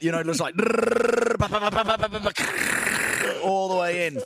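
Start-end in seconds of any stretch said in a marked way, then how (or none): 0.6-3.4 high rattling machine noise; buzz, then rapid puh-puh-puh into a grinding crunch; vocal sound effect of a chipper chewing; imitated wood chipper. Imitates rake going through wood chipper.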